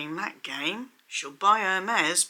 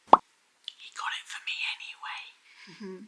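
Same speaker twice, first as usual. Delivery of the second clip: whisper; 4 dB quieter than usual. Retold or imitated. Secretly slipping her champagne.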